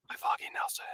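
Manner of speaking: whispered as a private, wondrous realization; awed whisper. Acted. Man greeting a newfound best friend, in an awed whisper. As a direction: breathe it out in a hushed, reverent whisper.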